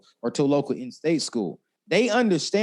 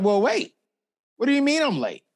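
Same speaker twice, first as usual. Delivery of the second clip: much higher, louder, stunned disbelief; retold shock; 12 semitones higher, 4 dB louder than usual. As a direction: replay the moment the news hit you. Retold shock at being late.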